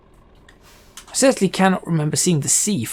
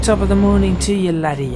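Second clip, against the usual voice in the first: hammed-up Irish accent, quick and raised; 4 semitones higher, 4 dB louder, faster than usual. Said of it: "stereotypical Irish talk".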